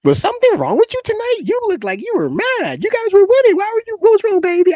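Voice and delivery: worried mom. Retold or imitated. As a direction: fuss over her son like a concerned mama. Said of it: "my mom was like".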